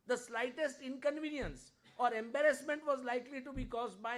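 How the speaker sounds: loud, forceful declamation of a speech; oratorical quote reading. A narrator's reading. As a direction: deliver it like an orator driving home an indictment. Reads on from Shah's speech, forcefully.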